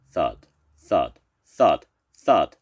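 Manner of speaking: loud, heavy spoken thuds; thudding footsteps. A narrator's reading. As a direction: stomp each word like a giant footfall. Narrator imitates monster footsteps.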